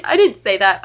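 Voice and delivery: ordinary talk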